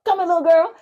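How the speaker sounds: high-pitched imitation of a man calling out; mock yelling. Man running at her down the street, mock yelling. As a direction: mimic a yell without full volume. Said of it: "This man just yelling".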